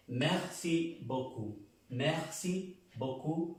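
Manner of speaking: "Merci beaucoup" slow, with a silent P; slow French phrase. Shown as a demonstration. Teacher slowly modelling "Merci beaucoup" for students. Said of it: "Esta P no se pronuncia".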